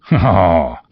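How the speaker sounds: loud happy exclamation; merry cry. Acted. Fezziwig cries out loudly to his lads. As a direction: cry out with delight. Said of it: "cried old Fezziwig".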